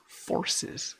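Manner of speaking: forces said clearly with a crisp F; stress on forces. Shown as a demonstration. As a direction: make sure forces is heard with an F, not horses. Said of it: "With an F".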